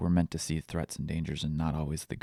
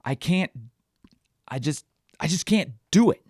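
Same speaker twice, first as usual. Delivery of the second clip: raised, high voice, halting in desperation; retold desperate shout; 7 semitones higher, 4 dB louder than usual. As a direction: re-enact a parent's shout at breaking point. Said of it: "and I shout".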